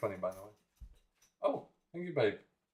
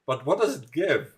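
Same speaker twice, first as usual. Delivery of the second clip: laughing; 7 semitones higher, 8 dB louder than usual. Retold or imitated. Mock reply on friend's post.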